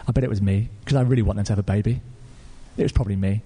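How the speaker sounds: low-pitched, musing self-doubt; deflated doubt. Retold or imitated. Young man doubts God spoke to him, low deflated doubt. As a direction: talk yourself out of it, subdued.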